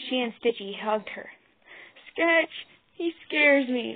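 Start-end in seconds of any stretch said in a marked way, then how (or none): 2.1-4.0 put-on high, slow child's whimper; small frightened plea. A narrator's reading. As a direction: whimper it like a scared little one. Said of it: "whimpered Rosie".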